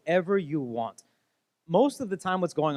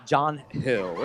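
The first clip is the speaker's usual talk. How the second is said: imitating a cool, innocent denial; mock-casual cover-up; smooth; 4 semitones lower than usual. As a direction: play it smooth, a little lower. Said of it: "Michelle's smooth".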